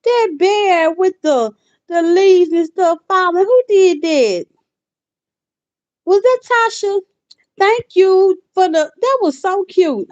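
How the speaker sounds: high, loud, thrilled gushing; delighted squeal. Real, not acted. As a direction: gush over the cute gift. Reacting to a viewer's gift.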